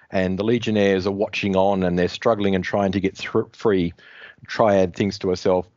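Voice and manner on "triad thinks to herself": ordinary delivery, nothing marked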